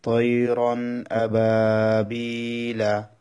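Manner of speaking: তৈরন আবাবিলা chanted very slowly; Quranic recitation. Shown as a demonstration. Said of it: "তারপর".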